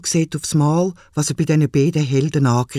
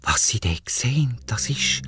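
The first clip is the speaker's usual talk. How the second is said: hushed suspense; 4 semitones lower, 4 dB quieter than usual. A narrator's reading. Suspense in narration.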